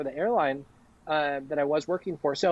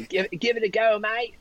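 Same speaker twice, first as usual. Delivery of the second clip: higher-pitched, hammed-up Australian accent; terrible Aussie accent; 7 semitones higher than usual. Shown as a demonstration. Friends tease the speaker for this slogan, terrible Aussie accent. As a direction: put on a bad Aussie twang. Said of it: "this terrible Aussie accent comes out".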